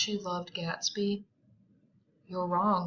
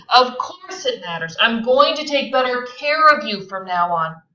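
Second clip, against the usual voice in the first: loud, forceful reply; loud retort; 3 semitones higher, 10 dB louder than usual. Acted. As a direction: assert it forcefully.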